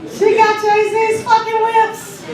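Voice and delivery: mock admiring. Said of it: "and I was like".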